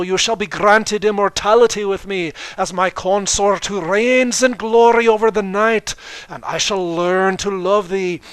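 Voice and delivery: put-on Scottish accent, loud, high and growing; Scottish queen accepting with exasperation; Scottish Luna. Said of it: "said Luna scottishly".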